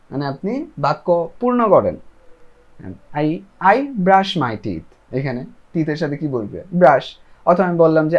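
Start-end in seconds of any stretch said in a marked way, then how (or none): 3.1-5.2 each word slow and separate; slow model sentence. Shown as a demonstration. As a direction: spell it out slowly for learners. Teacher models answer.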